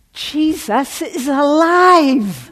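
slow, high, loud joyful cry with laughter; ecstatic shout. Retold or imitated. Friend calling at work with news, joyful shout. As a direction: shout the news like you can't contain it.

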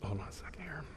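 soft whisper to himself; whispered aside. Real, not acted. Preacher whispers an aside to the congregation. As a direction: break off in a hushed whisper. Aside while telling story.

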